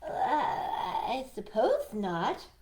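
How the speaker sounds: slow, hesitant, lightly voiced rabbit; a polite rabbit giving in unwillingly; reluctant Rabbit. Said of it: "Rabbit said".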